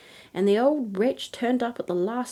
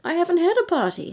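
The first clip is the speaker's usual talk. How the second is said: flustered queen; 10 semitones higher, 5 dB louder than usual. A narrator's reading. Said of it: "said the queen".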